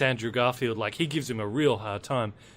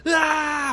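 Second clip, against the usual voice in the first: high, loud "ah!" yell; imitated scream; 6 dB louder than usual. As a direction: cry out high and loud like a kamikaze attacker. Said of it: "just grab you and go".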